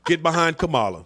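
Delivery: ordinary delivery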